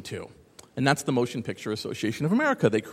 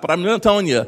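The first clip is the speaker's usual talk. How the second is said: ordinary talk, nothing marked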